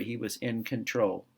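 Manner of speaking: soft, settled final statement; hushed and certain; quiet emphatic. Shown as a demonstration. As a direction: drop the voice to land the point. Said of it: "repeats key line for emphasis".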